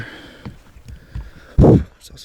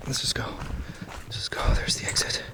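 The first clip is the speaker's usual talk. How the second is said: whisper; 12 dB quieter than usual. Real, not acted. Fleeing quietly from haunted spot.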